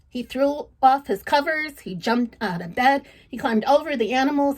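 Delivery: ordinary delivery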